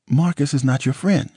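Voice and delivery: high, tearful woman's voice; Nicole's upset voice. Acted. Nicole warns him, near tears.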